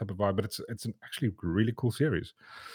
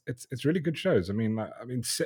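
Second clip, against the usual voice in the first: ordinary talk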